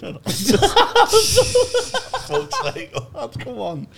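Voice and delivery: high-pitched laughing protest, slow; laughing exasperation. Retold or imitated. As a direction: laugh through a mock plea to the heckler.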